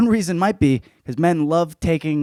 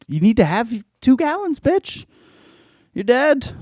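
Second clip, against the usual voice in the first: mock yell; 11 semitones higher than usual. Real, not acted. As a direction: pitch shoots high on "bitch". Mock scolding of unprepared hikers.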